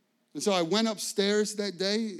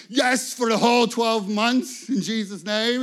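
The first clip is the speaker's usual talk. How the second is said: bold faith declaration; 5 semitones higher, 10 dB louder than usual. Retold or imitated. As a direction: declare it like a proclamation. Preacher answers with faith boast.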